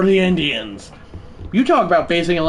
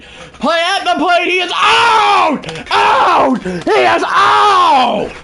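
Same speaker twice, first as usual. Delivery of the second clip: much higher, loud slow shouted call; excited shout; 14 semitones higher, 6 dB louder, much slower than usual. Acted. Gamer calling the play at the plate, shouting announcer. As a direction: yell the out like a frantic announcer.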